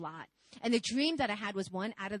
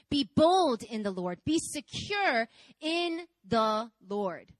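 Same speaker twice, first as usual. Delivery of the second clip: emphatic preaching; 5 dB louder, slower than usual. Real, not acted.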